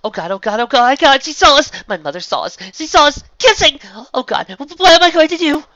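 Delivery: high, loud frantic babble; breathless panicked outburst; panicked rambling. A narrator's reading. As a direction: spiral into panic as you pace. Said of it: "As Kim continued to pace and ramble".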